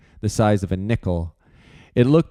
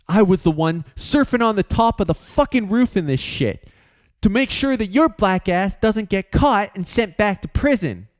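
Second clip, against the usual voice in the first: higher voice, hot and accusing; indignant rant; 11 semitones higher than usual. Acted. Aiden berating George during the quarrel, indignant. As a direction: throw his effort in George's face.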